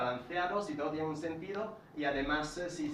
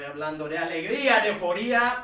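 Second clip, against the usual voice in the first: higher and louder, bursting with euphoria; joyful high voice; 4 semitones higher, 10 dB louder than usual. Shown as a demonstration. Speaking coach showing students an excited, higher, louder voice. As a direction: lift the voice up like you're overjoyed. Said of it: "puedo tener un tono más alto".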